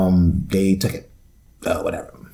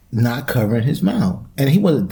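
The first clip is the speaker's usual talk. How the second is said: ordinary delivery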